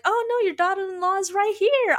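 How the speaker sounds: bright, high, eager offer; high, cheerful imitation of a bold reply; playful cheeky quote. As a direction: pitch it up high like a cheeky volunteer. Cheeky retold quote in a drama recap.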